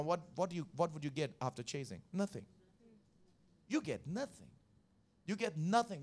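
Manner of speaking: ordinary talk, nothing marked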